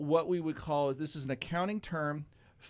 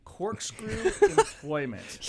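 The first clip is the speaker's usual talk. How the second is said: laughing; 6 semitones higher, 4 dB louder, much slower than usual. Real, not acted. Repeating the funny word, laughing.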